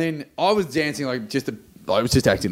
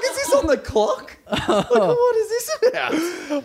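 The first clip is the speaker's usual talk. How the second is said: higher, slow, laughing through the words; incredulous and cracking up; laughing disbelief; slower than usual. Retold or imitated. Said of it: "I'm like".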